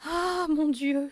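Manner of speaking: slow, loud, high wail; horrified exclamation. A narrator's reading. Mother throwing herself at husband, horrified cry. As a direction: wail it in horror. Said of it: "s'exclama la mère".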